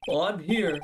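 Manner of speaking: pompous lawyer. Acted. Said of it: "now I gotta give him a voice".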